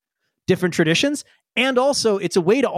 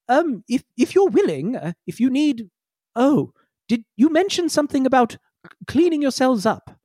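higher, halting polite robot; hesitant bot; 7 semitones higher than usual. Acted. Chanti Kalo nervously asks the crew about cleaning up, higher halting voice. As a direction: fumble politely over the question.

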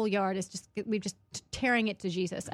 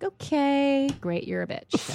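mock-calm brush-off, starting high and dropping; imagined calm reply; 6 semitones higher, slower than usual. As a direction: pretend to shrug off an insult. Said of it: "I could have just been calm".

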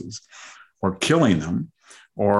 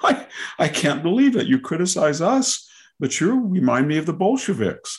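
higher, lightly accented mock Putin; 8 semitones higher than usual. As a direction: lampoon Putin's words in a higher put-on voice. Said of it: "what he said was".

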